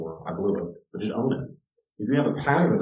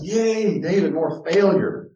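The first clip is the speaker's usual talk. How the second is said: sarcastic cheer; 10 semitones higher, 5 dB louder, much slower than usual. Acted.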